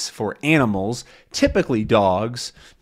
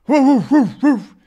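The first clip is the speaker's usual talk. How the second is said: loud, high imitated barks; dog barking; 6 dB louder than usual. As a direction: keep barking like an angry dog. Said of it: "He keeps barking at Tintin".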